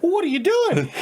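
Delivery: high, loud Boston accent, mock Mark Wahlberg cop; Mark Wahlberg impression. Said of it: "'cause he'd be like".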